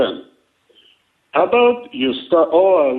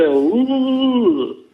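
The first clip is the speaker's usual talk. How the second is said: long mock "ooh", pitched up; imitated fat-man sound; 5 semitones higher than usual. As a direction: draw out a higher, mocking ooh. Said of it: "This is what I hear".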